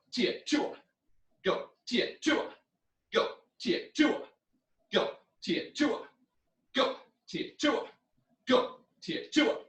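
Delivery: high shouted kiai on each count; karate yells. Shown as a demonstration. Teacher drilling punches with yells. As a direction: yell with every punch on go. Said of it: "punching with the front hand".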